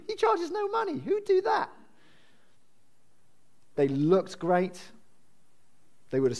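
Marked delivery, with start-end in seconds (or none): 0.1-1.7 squeaky-high pretend disbelief; mock incredulous. Corinthians scoffing that Paul didn't charge, mock incredulous. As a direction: send up the scoffers, voice leaping right up. Mimics scornful critics of Paul.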